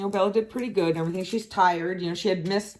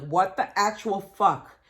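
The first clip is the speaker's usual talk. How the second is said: slow, louder, drawn-out outrage; outraged disbelief; 3 semitones higher, 4 dB louder than usual. Retold or imitated. Back home to a hot house, slow outraged swearing. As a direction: stretch each word in furious disbelief. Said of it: "I'm like".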